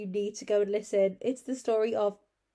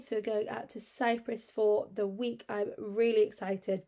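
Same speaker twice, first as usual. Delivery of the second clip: normal talking voice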